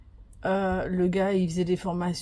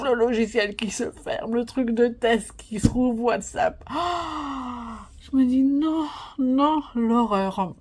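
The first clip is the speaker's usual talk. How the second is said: higher, rising mock panic; dramatised gasping dismay, louder on the cries; retold horror; 5 semitones higher, 4 dB louder, slower than usual. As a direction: act out watching disaster strike. Retelling the crash with dramatised horror.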